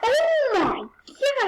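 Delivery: slow, high, loud shock; shocked cry. Real, not acted. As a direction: wail it in disbelief.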